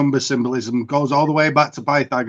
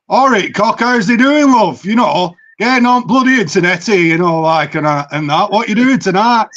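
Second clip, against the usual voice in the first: higher, louder broad northern English accent; 4 semitones higher, 4 dB louder than usual. Shown as a demonstration. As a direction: lay on his home northern English accent. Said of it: "if I speak with my actual accent".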